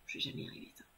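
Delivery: discouraged, voiceless mumble to herself; very soft despairing whisper; whispered aside. Real, not acted. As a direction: whisper it under your breath, defeated.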